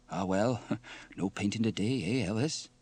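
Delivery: ordinary delivery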